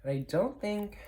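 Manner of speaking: high, doubtful protest; incredulous. Real, not acted. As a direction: sound like you can't believe the price. Complaining about side price.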